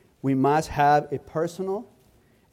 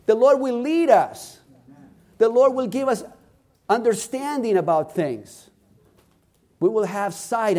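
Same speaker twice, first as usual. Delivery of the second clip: raised, higher-pitched preaching voice; impassioned sermon delivery; fervent preaching; 6 semitones higher, 5 dB louder than usual. Real, not acted. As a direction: preach it with fervour, driving each phrase home. Preaches with rising fervour.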